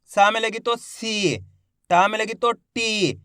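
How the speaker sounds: slow drill, holding the ee in "सी" and "टी"; drawn-out long vowels. Shown as a demonstration. Teacher leading learners who repeat along, slow with long vowels. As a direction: stretch "सी" and "टी" at each end. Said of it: "खींच के करेंगे इसका उच्चारण".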